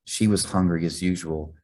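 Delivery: usual speaking voice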